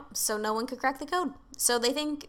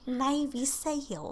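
put-on Australian accent; 5 semitones higher, much slower than usual. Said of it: "say Navy seals in an Australian accent".

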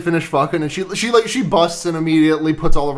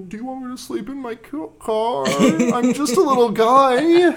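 pleading little guy voice; 7 semitones higher than usual. Retold or imitated. Voicing Herbert pleading to move in.

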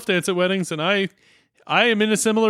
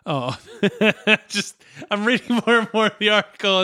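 ordinary delivery

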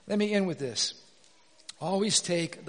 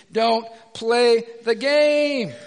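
slow, punched, louder warning; emphatic command; 3 semitones higher, 8 dB louder, much slower than usual. Real, not acted. Preacher warning the congregation off online fights, slow and emphatic. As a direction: lay down the rule, word by word. Sermon exhortation, slow and loud.

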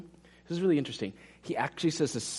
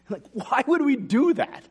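incredulous; 11 semitones higher, 5 dB louder than usual. Real, not acted. Preacher's incredulous question.

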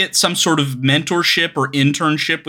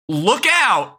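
mock yell, slightly raised pitch; imitated warning yell; 3 semitones higher than usual. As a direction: call it out like a half-hearted warning. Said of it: "running up to a man and yelling".

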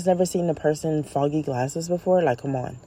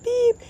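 short high beep like a horn; mock car horn. Other driver blocking Stan, a quick high beep. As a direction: honk it high again, quick. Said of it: "and they're like".

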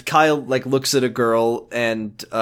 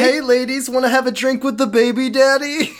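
higher, cheesy pickup voice; hammed-up cheesy flirt; 9 semitones higher than usual.

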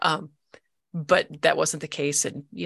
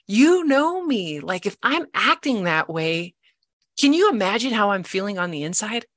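exasperated pleading; 6 semitones higher than usual. Retold or imitated. Wife pleading with her ex-husband to understand her, exasperated. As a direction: implore him, stressing the key words.

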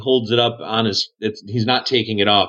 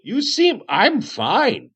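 high, wavering sing-song hermit voice; Aniston, dotty cave hermit insisting he's fine; 9 semitones higher, slower than usual. Acted. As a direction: insist you're fine, pitched way up.

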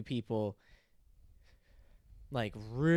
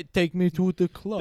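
put-on loud, high Russian-accented shout; hammed-up Russian clubber; mock Russian accent; 6 semitones higher, 11 dB louder than usual. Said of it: "trying to do a Russian".

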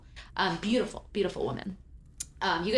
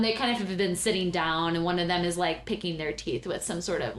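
ordinary delivery

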